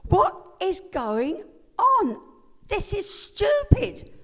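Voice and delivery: loud, high, slow near-crying outburst at God; angry outburst. Retold or imitated. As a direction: shout it at God with a break of tears. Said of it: "I was angry at God".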